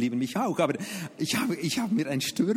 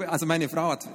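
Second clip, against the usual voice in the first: ordinary talk, nothing marked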